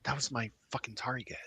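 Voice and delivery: hushed angry whisper. Acted. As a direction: curse under the breath. Whispered realization.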